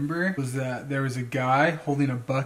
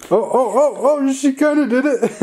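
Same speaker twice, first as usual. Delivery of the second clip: high, loud delighted squeal; thrilled the dog nearly got it; excited; 12 semitones higher, 8 dB louder than usual. Real, not acted. Dog almost does trick.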